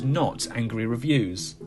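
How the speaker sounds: normal talking voice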